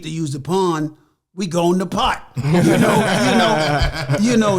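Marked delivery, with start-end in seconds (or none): 2.3-4.6 loud laughing through the words. Real, not acted. After making a pot pun, laughing loudly. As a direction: laugh at your own pun.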